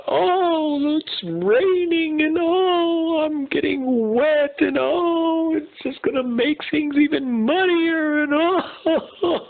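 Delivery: high, slow, whiny sobbing zebra voice; overly dramatic zebra. Acted. Zebra stuck in the mud wailing to himself, hammed-up sobbing. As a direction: wail like a hammed-up crybaby zebra. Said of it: "the zebra went on and on about".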